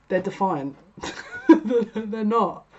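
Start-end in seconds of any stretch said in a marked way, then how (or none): 1.9-2.6 laughing through the words. Real, not acted. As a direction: chuckle it out. Laughing at the cards.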